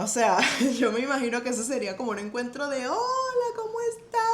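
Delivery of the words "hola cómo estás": high, chirpy mock greeting; friendly greeting. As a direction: play it like a cheery hello between old friends. Imagined friendly greeting with Ahab's steward. (saludo alegre y agudo, medio en broma)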